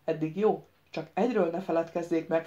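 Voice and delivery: ordinary delivery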